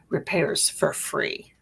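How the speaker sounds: ordinary talk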